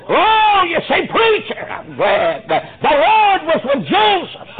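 high, loud, slow preacher's cry; preaching at full force. Acted. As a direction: lift the congregation to the climax.

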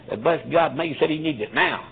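ordinary delivery

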